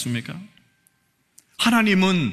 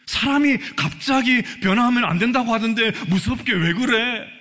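higher, wary and needling; a mildly played suspicious wife; 5 semitones higher than usual. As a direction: grill the husband over what he did. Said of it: "이렇게 말하지 않겠습니까?".

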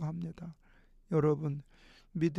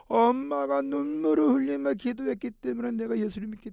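higher and louder, pouring out gratitude; emotional outpouring; 7 semitones higher, 7 dB louder than usual. Retold or imitated. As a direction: let the feeling swell up. Son thanks mother, emotional peak.

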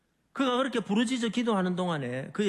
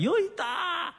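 high-pitched holler of "here I am"; sudden high mock shout; calling out; 9 semitones higher, much slower than usual. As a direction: call out as if giving away a hiding place. Hiding fugitive shouting his location.

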